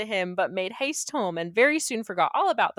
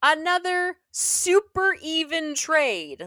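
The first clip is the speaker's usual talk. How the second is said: slow, high mock-sarcastic drawl; sarcastic jab at the even trade; 7 semitones higher, 5 dB louder, much slower than usual. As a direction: drag it out, dripping with sarcasm. Sarcastic aside about the deal.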